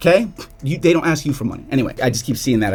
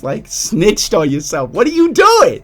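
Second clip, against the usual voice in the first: slow then very high, loud what are you doing; incredulous; 8 semitones higher, 5 dB louder, slower than usual. Retold or imitated. Vlogger replying to scammer DMs in disbelief. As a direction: drag it out, then explode in disbelief.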